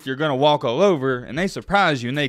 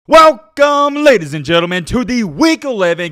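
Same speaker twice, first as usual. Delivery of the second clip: loud, high announcer welcome; big game-show welcome; hyped show intro; 7 semitones higher, 10 dB louder than usual. Acted. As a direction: belt it out like a host opening the show.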